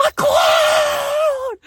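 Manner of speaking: very high, loud delighted squeal; excited shout. Retold or imitated. Little girl spots the mime and squeals, high and loud. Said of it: "This other little girl's like".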